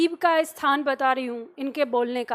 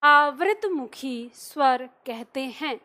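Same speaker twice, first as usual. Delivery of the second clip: slow, word-by-word dictation of आवृतमुखी स्वर; teacher dictating notes at a slow pace; 3 semitones lower, slower than usual. Shown as a demonstration. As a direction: dictate slowly so students can write. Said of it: "लिखिए".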